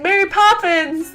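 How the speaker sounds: high, loud, drawn-out greeting; excited calling out. Retold or imitated. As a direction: holler it slowly in a high, delighted voice. Greeting the actor by role, retold.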